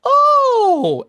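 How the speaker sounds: high, loud startled gasp of an oh; imitating a startled woman at the door; surprised oh. Lady at the door sees the member, startled oh. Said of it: "and goes".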